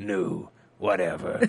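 low-pitched character imitation; Dyson. Host correcting a slip while still in the low Dyson impression. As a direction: keep up the low mimicked voice. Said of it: "it's a Dyson impression".

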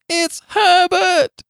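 very high, loud, drawn-out scream. A narrator's reading. Old woman realising her son knocks, high drawn-out scream. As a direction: scream it slowly at the top of the voice. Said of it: "she screamed".

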